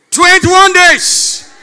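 slow, loud, high preaching; preaching shout. Acted. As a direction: proclaim it slowly to the crowd. Preacher repeats at full force.

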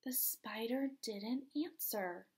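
soft whispered narration; whispering. Acted. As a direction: whisper it to the children. Read-aloud aside.

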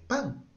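high little pam; mock sound effect. Teacher mimicking the audio starting, short and high. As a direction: pop it out like a button press.

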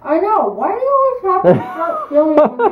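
loud, laughing complaint; laughing protest. Real, not acted. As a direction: protest loudly through a laugh. Laughing protest at being filmed.

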